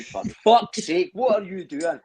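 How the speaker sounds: Scottish dad losing it at someone; exasperated Scot. Retold or imitated. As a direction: play a fed-up Scots dad scolding. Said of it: "get the person to a T".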